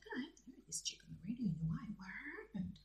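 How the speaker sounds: quick whispered musing; whispered wondering. Retold or imitated. Wondering why she has been off the radio, whispered. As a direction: wonder to yourself under your breath. Retells own wondering to self.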